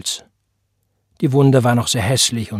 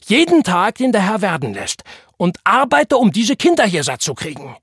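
high, loud furious tirade; angry shout; 9 semitones higher, 6 dB louder than usual. A narrator's reading. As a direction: yell out the grievance. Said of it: "schrie er". (wütend weiterschreien)

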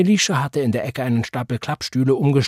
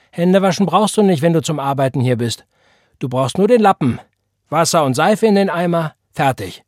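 cheeky loud orders; 6 semitones higher, 7 dB louder than usual. A narrator's reading. John bossing Elisha around.

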